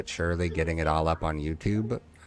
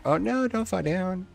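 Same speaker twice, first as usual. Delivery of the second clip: alarmed. Real, not acted. Piece about to fall over.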